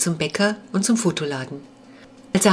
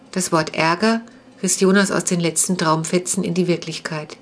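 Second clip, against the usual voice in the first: ordinary delivery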